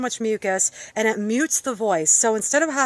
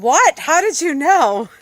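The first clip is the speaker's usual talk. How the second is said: higher, louder, astonished question; startled date; 6 semitones higher, 6 dB louder, slower than usual. Retold or imitated. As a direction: act out someone caught off guard. Acting out startled date's reply.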